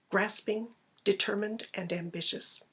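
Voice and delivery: plain reading voice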